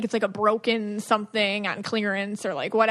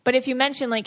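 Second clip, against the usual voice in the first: ordinary talk, nothing marked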